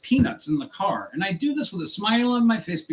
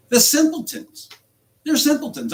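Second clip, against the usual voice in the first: scornful outburst, higher and louder; contempt; 10 semitones higher, 4 dB louder than usual. Real, not acted. Driver venting at Uber support, scornful outburst. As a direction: spit out the insult in disgust.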